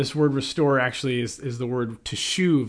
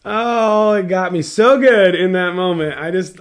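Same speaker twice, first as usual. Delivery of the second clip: laughing; 6 semitones higher, 10 dB louder than usual. Real, not acted. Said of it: "I laughed so hard".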